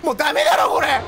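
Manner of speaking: ordinary delivery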